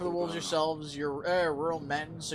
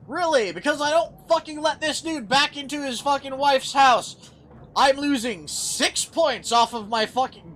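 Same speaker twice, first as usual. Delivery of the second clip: real furious shouting, much higher and louder; blowing up at the game's choice; furious rant; 8 semitones higher, 8 dB louder than usual. Real, not acted. Player furious at game outcome.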